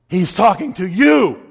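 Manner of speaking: loud, slow call landing on YOU; single out one listener from the pulpit; imitating a preacher calling out; preacher's accusing call. Retold preacher singles him out.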